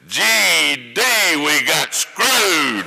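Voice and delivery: put-on old lawyer cursing. Old lawyer cursing the verdict in a packed courtroom, imitated slowly. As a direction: spit out the oath like a sore loser.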